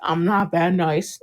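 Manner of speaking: low, slow imitated threat; slightly threatening. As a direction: repeat the quote with slow, understated menace. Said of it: "it sounded a little threaty".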